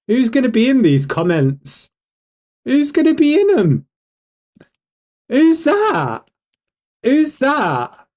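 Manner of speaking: high, leading questions; mock suspense. Real, not acted. Streamer revealing a commenter to the chat, mock suspense. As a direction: tease the reveal in a high, rising-excitement voice.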